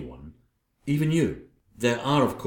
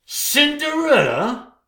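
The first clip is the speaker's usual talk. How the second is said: a high, slow, outraged cry of the name; shocked shriek; 5 dB louder, slower than usual. Acted. Queen hearing Cinderella named as fairest, shrieking in disbelief.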